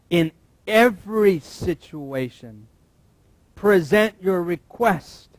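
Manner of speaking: slow and loud, every word weighted; slow emphatic reading. Shown as a demonstration. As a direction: lay each word down slowly.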